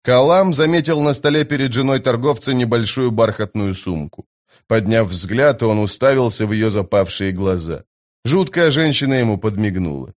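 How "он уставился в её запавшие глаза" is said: plain reading voice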